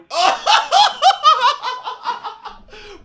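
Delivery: high, loud helpless cackle; burst of laughter. Real, not acted. Laughing loudly at the umpire's frozen strike call.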